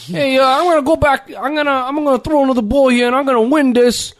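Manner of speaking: exaggerated East Coast accent spoof. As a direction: play a brash East Coast guy who's sure he'll win. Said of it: "everybody from the East Coast sounds".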